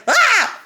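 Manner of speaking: sudden high, loud startled shriek. Retold or imitated. Reader voicing the startled boy at the door, shrieking. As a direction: jump out of your skin with a yelp. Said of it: "I would shriek".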